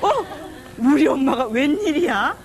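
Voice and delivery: high, loud, drawn-out astonishment; mock amazement, pitch climbing high; startled son. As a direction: imitate a son amazed at his mother. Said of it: "우리 아들이 지가 놀랬어".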